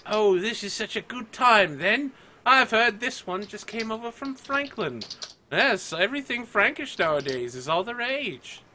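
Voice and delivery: high-pitched, delighted socialite chatter; gushing party guests. Acted. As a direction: gush like a guest at a fashionable ball.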